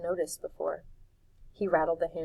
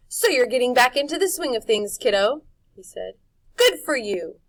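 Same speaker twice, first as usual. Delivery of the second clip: loud, higher pleased; Jive voice; 6 semitones higher, 9 dB louder than usual. A narrator's reading. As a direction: approve warmly.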